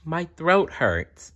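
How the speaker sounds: high, slow complaint; lightly voiced sick little girl; hurting girl. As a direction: sound like it hurts to talk. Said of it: "sore throat in story".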